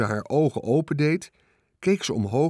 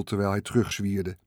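ordinary delivery, nothing marked

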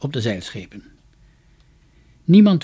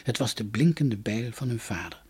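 ordinary delivery

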